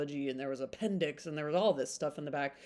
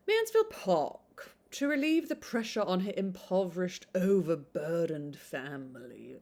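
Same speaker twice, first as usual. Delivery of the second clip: put-on British accent. Booktuber reading the Mansfield Park blurb to viewers in a British accent. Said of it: "I do do British accent".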